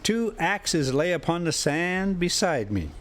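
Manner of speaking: ordinary delivery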